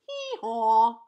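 loud imitated hee-haw; donkey bray. Donkey's bray in read-aloud story.

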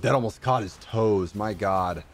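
usual speaking voice

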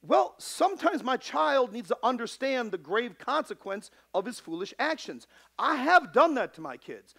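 loud, high, driving pulpit delivery; rising fiery sermon voice; preaching at full force. Acted. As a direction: preach it hard to the flock.

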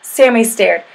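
plain reading voice

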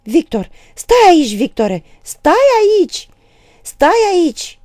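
high, loud, frantic shriek; screaming. A narrator's reading. Mother stopping her son at the door, screaming. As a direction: scream desperately to hold him back. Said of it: "Aristița țipă".

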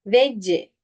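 "Veci" with Turkish v and an extra i; wrongly adding a vowel. Shown as a demonstration. Teacher imitates the error, "Veci" with added vowel. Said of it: "Bir de harekete veriyor".

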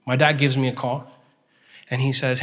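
normal talking voice

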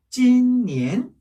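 "찐니엔" with long level "찐", then rising "니엔"; first-second tone. Shown as a demonstration. Teacher joining the syllables, "찐니엔" level then rising. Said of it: "이렇게요".

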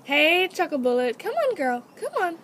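high, coaxing call to an animal; calling a pet. Real, not acted. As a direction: coax your pet out with a bright-pitched call. Calling guinea pig out of cage.

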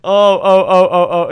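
loud groans with laughter; laughing through cramp pain. Real, not acted.

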